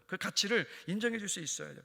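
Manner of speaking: ordinary delivery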